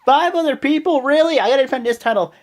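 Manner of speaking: loud, high, indignant imitation of a wrestler; mad outburst. Wrestler imitated protesting five challengers for his title, mad. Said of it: "he got mad".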